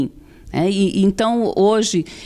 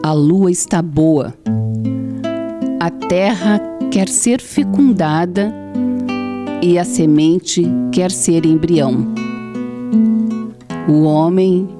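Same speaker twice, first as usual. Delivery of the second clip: poem recitation; 3 semitones lower than usual. Acted. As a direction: recite it solemnly, line by line. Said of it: "eu quero que tu diga outro poema pra nós".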